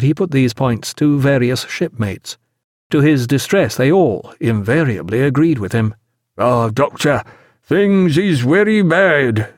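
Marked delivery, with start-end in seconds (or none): 6.4-9.5 slow, drawn-out sailor's lament, pitched up; doleful deckhand character voice; gloomy sailor. A narrator's reading. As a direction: draw out the bad news dolefully. Sailor voiced, distressed at lost rudder.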